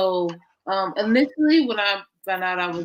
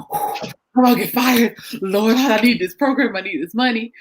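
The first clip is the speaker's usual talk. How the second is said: louder, panicky plea to the Lord; half-joking fearful prayer, pushed out loud; scared inner panic; 3 semitones higher, 7 dB louder than usual. Retold or imitated. About to confront her manager, retelling her fearful panic. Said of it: "I was scared. When I tell you, my heart was racing".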